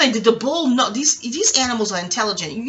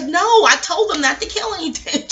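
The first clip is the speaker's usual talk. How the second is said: pitched-up retold protest; indignant denial; 7 semitones higher than usual. Teacher answering students about the meat, indignant. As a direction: insist on it, high and exasperated.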